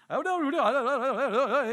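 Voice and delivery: high, loud mock babble; mimicking rapid translation. Imitating the translator going on and on, high babble. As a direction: rattle on in pretend foreign chatter. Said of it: "he's just like".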